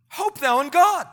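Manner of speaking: high, loud rallying call; firm exhortation. Acted. Preacher telling his own soul to hope, emphatic exhortation. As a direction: proclaim it as a command.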